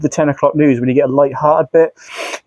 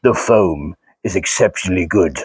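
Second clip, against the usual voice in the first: Darth Vader impression; 9 semitones lower than usual. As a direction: go deep and grave like Vader. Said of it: "how would Darth Vader say this?".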